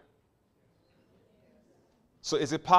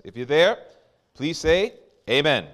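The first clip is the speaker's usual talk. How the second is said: calling out; 10 dB louder than usual. Real, not acted. Calling congregation to respond.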